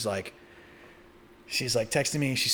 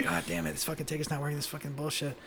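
put-on drunken muttering; 3 semitones higher, 8 dB quieter than usual. Drunk cursing at broken ticket.

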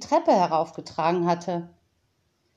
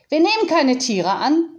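curt interruption; 7 semitones higher, 6 dB louder than usual. Acted. Unterbrach die eine Frau.

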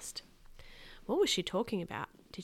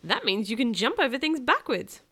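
put-on loud, higher, excited kid; excited squeal; 4 semitones higher, 7 dB louder than usual. A narrator's reading. Kenny to his brother the new ninja, loud and excited. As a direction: gush it loudly like a thrilled little brother. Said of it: "Kenny squealed".

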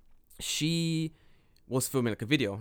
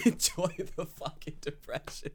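laughing; 4 semitones higher than usual. Real, not acted. Laughing repeat of cohost.

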